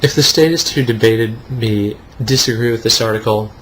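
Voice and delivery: normal talking voice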